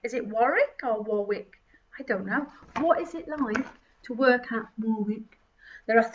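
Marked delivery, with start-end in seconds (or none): none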